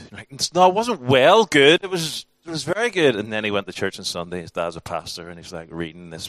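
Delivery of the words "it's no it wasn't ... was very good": much louder and higher, fed-up dad; exasperated correction. Retold or imitated. Pastor dad correcting his son, loud and exasperated. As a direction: raise your voice at the kid's grammar.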